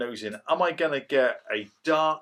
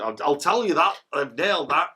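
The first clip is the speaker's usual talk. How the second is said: louder, higher mock Yorkshire brag; Yorkshire accent; 5 semitones higher, 7 dB louder than usual. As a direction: slip into a playful Yorkshire accent. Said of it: "I don't know why I did Yorkshire".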